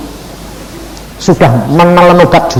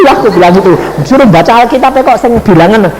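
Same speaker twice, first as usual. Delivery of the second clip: usual speaking voice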